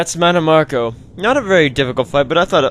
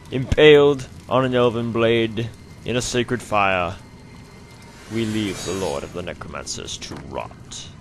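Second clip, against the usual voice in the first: mock-epic narration; 2 semitones lower, 4 dB quieter, slower than usual. Player narrates the necromancer left to rot, mock-epic. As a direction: intone it like a grim epilogue.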